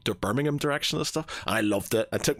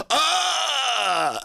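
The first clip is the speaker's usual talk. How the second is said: high held dying scream, hammed up; a pretend victim's scream in a party game; mock death cry; 13 semitones higher than usual. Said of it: "really goes".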